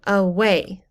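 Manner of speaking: "away" drawn out slowly with WAY stressed; stress on second syllable. Shown as a demonstration. Teacher illustrates an iamb, second-syllable stress. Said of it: "the second syllable, is said more loudly".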